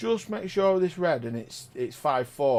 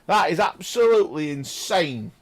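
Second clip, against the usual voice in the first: usual speaking voice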